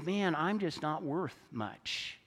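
soft, slow, defeated self-talk; acted dejection. Voicing the thought after a failed effort, mildly dejected. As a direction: let it sink quietly into mild self-pity.

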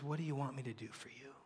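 low, very soft question; quiet, compassionate offer; gentle asking. Acted. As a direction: ask tenderly, voice dropped right down. Jesus gently addresses the blind man.

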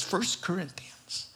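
ordinary talk

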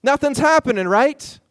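high, loud mocking taunt; sarcastic rebuke. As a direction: scold the rebels with scorn. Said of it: "it's almost like he says".

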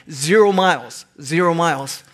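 usual speaking voice

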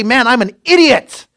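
high, loud, exasperated self-reproach; the man in the mirror scolding himself, loud and high; frustrated self-talk. Retold or imitated. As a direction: berate yourself in the mirror, voice up high. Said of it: "look in the mirror and just say".

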